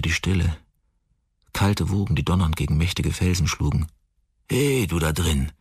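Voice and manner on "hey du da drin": betrunkene Stimme. A narrator's reading. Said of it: "schrie eine betrunkene Stimme".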